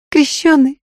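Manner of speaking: high, agitated pleading; hoarse with agitation. A narrator's reading. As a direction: beg them in an anxious, husky woman's voice. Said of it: "сиплым от волнения голосом попросила".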